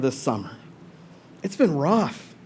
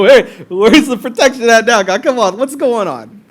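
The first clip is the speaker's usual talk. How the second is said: frustrated pleading; 5 semitones higher, 6 dB louder than usual. Acted. Acts out a frustrated believer.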